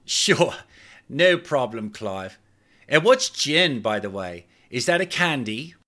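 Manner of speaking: put-on young woman in Cockney, naively agreeing; Cockney accent. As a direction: innocent Cockney question. Shimiso in Cockney.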